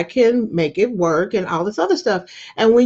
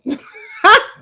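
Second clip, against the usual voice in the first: loud laugh; 9 semitones higher, 8 dB louder than usual. Real, not acted. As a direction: burst of high laughter. Laughs at the memory.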